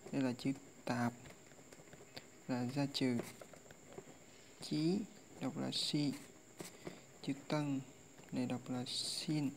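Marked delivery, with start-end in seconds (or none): none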